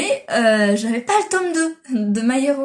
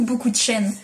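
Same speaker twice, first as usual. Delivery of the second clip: ordinary delivery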